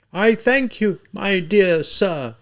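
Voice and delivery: high, slow and lofty, looking down on him; haughty. A narrator's reading. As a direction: thank him with icy grandeur. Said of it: "she began in a haughty manner".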